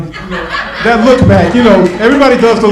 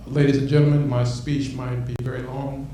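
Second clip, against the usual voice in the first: pompous speech opener; 5 semitones lower, 9 dB quieter than usual. Shown as a demonstration. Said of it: "I'm gonna give a one-minute speech".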